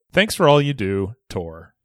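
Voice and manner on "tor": usual speaking voice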